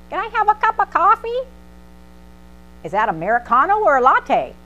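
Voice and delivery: high bright barista patter; chirpy barista. Retold or imitated. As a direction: rattle off the options like an eager barista. Barista and grandma voiced.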